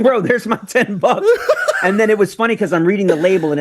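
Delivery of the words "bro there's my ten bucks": high, slow, laughing "bro"; laughing complaint. Retold or imitated. To the audience as the can dies, high slow laughing. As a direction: laugh it off as the can runs dry. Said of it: "I'm like".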